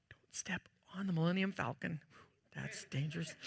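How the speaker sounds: real hushed whisper; whispered warning about the toy. Real, not acted. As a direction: whisper it as a quick aside. Aside near a toy.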